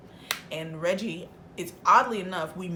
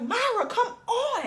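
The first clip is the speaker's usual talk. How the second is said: high, drawn-out pleading call; exasperated high-pitched holler, acted out; frantic urging; slower than usual. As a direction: whine urgently at a lagging friend, stretching the words. Retold urging of lagging friend.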